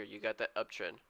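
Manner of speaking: ordinary talk, nothing marked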